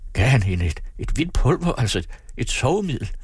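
high, halting, taken aback; flustered. A narrator's reading. Father asks about the sleeping powder, flustered. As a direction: stumble over the words in astonishment. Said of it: "stirrede en stund forfjamsket".

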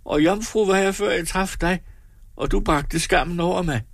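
a hint of weeping woman; sobbing on. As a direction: keep sobbing out the blame. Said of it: "Hun støftede videre".

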